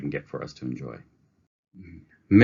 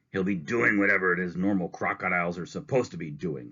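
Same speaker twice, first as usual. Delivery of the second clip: snapping reply. Acted. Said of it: "snapped Mister Grumps".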